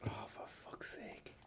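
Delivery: breathy fed-up whisper under the breath; exasperated whisper. Real, not acted. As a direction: whisper it in defeat. Exasperated after failed search.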